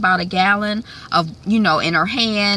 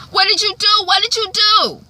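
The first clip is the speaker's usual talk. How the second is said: higher, louder accusing demand; much higher, harsher repeated question; accusing yell; 13 semitones higher, 4 dB louder than usual. Retold or imitated. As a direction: confront her hard. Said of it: "Maddie is running after".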